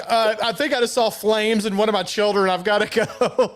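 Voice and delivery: urgent excuse to hang up, played for laughs; mock panic. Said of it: "I'm like".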